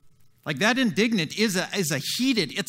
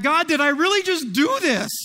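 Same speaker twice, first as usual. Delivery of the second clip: disbelief; 8 semitones higher, 5 dB louder than usual. Retold or imitated. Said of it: "having this moment of like".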